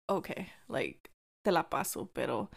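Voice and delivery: low, subdued reluctant okay; grudging acceptance. Retold or imitated. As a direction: let it slide quietly, with reservations. Retold grudging acceptance of apology.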